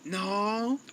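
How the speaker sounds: high, shocked mock son protesting. Son's shocked reply quoted.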